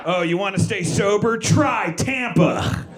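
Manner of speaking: God laying down a mocking challenge; taunting God. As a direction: toss down a mock challenge from on high. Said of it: "felt like a test from God".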